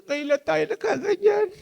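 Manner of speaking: high imitation of a wife choked with happy tears; tearful wife. Wife crying over their first real Christmas, high tearful imitation.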